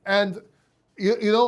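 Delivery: ordinary delivery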